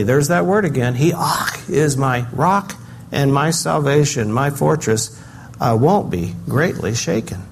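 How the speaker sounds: usual speaking voice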